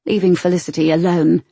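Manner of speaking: plain reading voice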